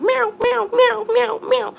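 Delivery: high, loud mocking meows; cat meowing. Cat taunting its owner from the neighbor's porch with high meows. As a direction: meow back like a sassy cat. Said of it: "she's just like".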